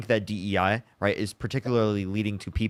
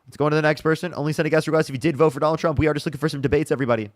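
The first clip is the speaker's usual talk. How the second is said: announcer voice; 5 semitones higher, 3 dB louder, faster than usual. Acted. Stream host plugging channel.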